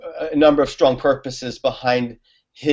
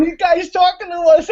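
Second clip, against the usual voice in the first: mock incredulous guy. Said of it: "and he's going".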